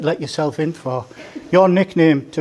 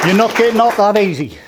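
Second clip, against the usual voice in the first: mock warning; 6 semitones higher, 6 dB louder than usual. Mock threat to groom in speech.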